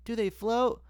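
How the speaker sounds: put-on high, eager little boy; child's question. Retelling the gutter scene, high kid voice. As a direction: voice Georgie asking innocently. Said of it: "And he's like".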